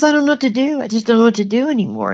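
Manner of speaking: high-pitched, helpless quote; retold despair. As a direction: imitate a husband at the end of his rope. Husband crumples, retold by wife.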